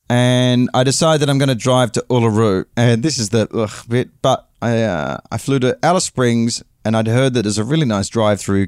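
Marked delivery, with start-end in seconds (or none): none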